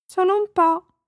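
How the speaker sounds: put-on high little girl voice. Bambina risponde al vecchietto. (voce acuta da bambina)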